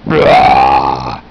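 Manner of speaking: deep growling monster roar. Acted. Pet monster Psycho Pants roars at the guy, deep growl.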